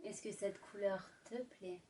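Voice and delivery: seduce the listener gently; sultry narrator. Acted. Intimate ASMR roleplay, lipstick.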